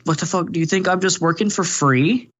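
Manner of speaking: imitating her indignant rant; mock angry streamer; mock outraged streamer. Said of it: "then she's like".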